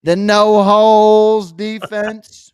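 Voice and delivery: slow, loud, stretched announcer voice; mock announcer. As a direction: boom it out like a promo. Joking announcer bit.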